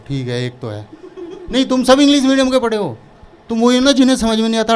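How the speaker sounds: usual speaking voice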